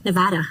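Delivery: Nevada with a short flat a, nuh-VAD-uh; American Nevada. Shown as a demonstration. Agent proudly saying Nevada the American way. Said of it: "I said it".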